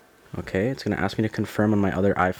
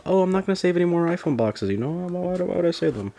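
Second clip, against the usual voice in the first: mock self-quote; 9 semitones higher than usual. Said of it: "after I told myself".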